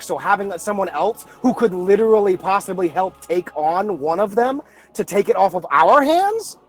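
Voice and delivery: ordinary talk, nothing marked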